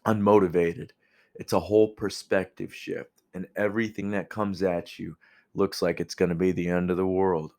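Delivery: normal talking voice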